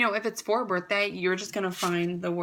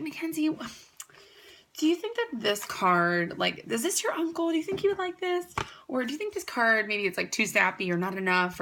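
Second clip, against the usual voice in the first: higher, questioning mom asking about cards; mom's voice; 4 semitones higher than usual. Retold or imitated. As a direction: voice a fussing mom in the card aisle, higher. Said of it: "she'd be like".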